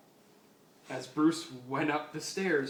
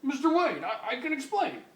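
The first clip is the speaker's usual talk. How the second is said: nervous commissioner; 10 semitones higher, 3 dB louder than usual. Acted. Caught commissioner stammers.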